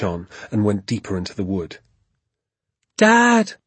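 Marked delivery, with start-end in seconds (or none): 3.0-3.5 high, loud searching call; shouting for Dad. A narrator's reading. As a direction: call out to a lost father in the woods. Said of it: "I shouted".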